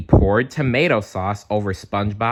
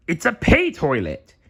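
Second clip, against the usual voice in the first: high crab voice explaining; Mister Krabs; 8 semitones higher, 4 dB louder than usual. A narrator's reading. Mister Krabs showing off his pay toilet, explaining. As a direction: explain it like the stingy crab boss. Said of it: "Mister Krabs explained".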